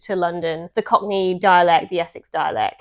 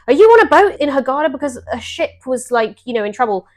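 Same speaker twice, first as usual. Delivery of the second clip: higher-pitched anxious check-in, retold; concerned friend; 6 semitones higher, 7 dB louder than usual. Friend's worried call, retold.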